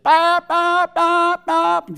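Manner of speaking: high, loud "bop" bursts; punchy high-pitched engine bops; imitated bike noise. Preacher mimicking his son's dirt bike, high imitated bops.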